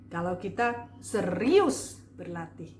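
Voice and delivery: "serius" drawn out with strong emphasis; slow down and lean on "serius"; stress on serius. Shown as a demonstration. Coach demonstrating emphasis, heavy stress on "serius". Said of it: "itu penekanannya pada serius tadi".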